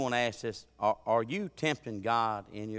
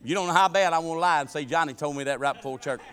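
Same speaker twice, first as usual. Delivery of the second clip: ordinary delivery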